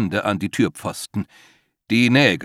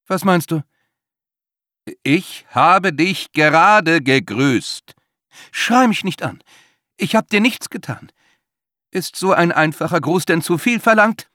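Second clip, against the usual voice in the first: high, pushy and indignant; an offended, insistent colleague; high pitched and loud, hurt indignation; Winston's voice; 8 semitones higher, 6 dB louder than usual. A narrator's reading. Narrator voices Winston character.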